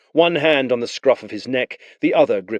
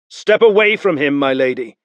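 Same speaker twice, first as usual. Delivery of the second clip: higher, louder stern command; commanding soldier; 5 semitones higher, 6 dB louder than usual. Acted. Blue Cloak orders the lady away, stern command. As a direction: order her aside, no arguing. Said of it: "he commanded".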